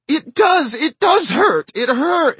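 put-on high, loud child's protest; crying child. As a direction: insist through tears like a little girl. Child insisting it hurts.

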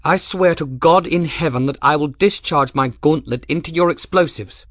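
high, loud imperious noblewoman; furious commanding lady's voice; grand hollywood dame. Acted. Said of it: "copy her mother's furious, imperious voice of command".

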